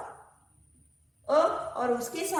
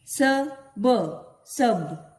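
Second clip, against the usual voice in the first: ordinary talk, nothing marked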